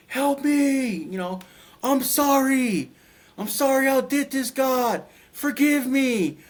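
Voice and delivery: high, slow, desperate begging; pleading souls. Retold or imitated. Lost souls in darkness screaming to God, pleading. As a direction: beg God for forgiveness in anguish. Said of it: "they were just screaming to God".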